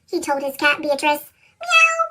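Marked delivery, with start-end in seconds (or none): 1.6-2.0 much higher, lightly voiced cat meow; a sleepy little meow; lazy cat meow. A narrator's reading. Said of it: "Beatrice said".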